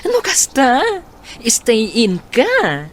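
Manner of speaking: high, loud surprised question. Acted. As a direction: react with sudden astonishment, then slow down. Friend surprised by live-in job.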